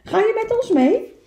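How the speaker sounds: high little animal voice. Acted. Animals invite the turtle.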